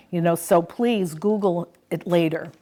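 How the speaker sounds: usual speaking voice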